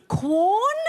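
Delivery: Quorn? with a high rising question lilt; apologetic question. Shown as a demonstration. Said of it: "It's so apologetic".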